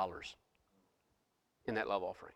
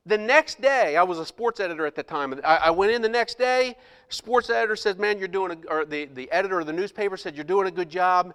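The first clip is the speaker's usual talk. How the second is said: preaching build; 6 semitones higher, 6 dB louder than usual. Acted. Pastor preaching to the congregation about the first check, loud and high. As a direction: lift the story into a preaching climax.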